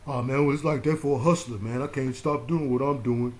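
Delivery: lightly voiced street hustler, whiny excuse; a hint of a hustler pleading.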